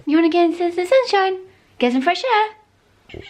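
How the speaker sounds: very high squeaky pet talk; dog voice. Real, not acted. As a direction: coo excitedly at the dog. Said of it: "Sorry about my dog voice".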